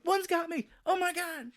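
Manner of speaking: high-pitched hammed-up terror; mock panic. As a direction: pretend something grabbed you, squealing. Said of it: "now you're doing the whole thing like".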